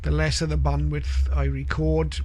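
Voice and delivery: ordinary delivery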